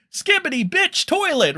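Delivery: high, loud goofy holler; mock hype yell. Streamer parodying stupid stream titles, mock hype yell. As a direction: shout it like a ridiculous clickbait title.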